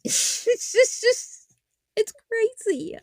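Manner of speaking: high, squeaky words lost in laughing; breaking into laughter. Real, not acted. Host overcome by how crazy it is, laughing. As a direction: try to talk through real laughter. Laughs while trying to speak.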